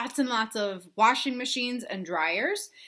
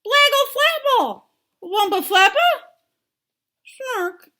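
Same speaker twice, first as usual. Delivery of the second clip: very high, loud, slow babbling; toddler babble; 11 dB louder, slower than usual. Acted. Toddler pleading with her daddy in babble, high and loud. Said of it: "She can't use her words yet".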